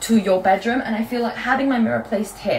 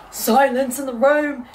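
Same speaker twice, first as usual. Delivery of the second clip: slow dramatic; 4 semitones higher, 3 dB louder, slower than usual. Shown as a demonstration.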